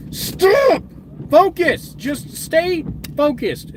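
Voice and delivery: high, loud pleading at the camera; frustrated yelling. Real, not acted. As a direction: yell at the camera to focus.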